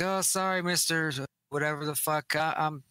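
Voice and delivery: lightly voiced scared kid backing off; apologetic kid. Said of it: "He's like".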